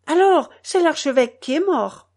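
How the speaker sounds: loud, high-pitched conclusion; alarmed retort. A narrator's reading. Old mother answering her son over the cathedral bell, alarmed.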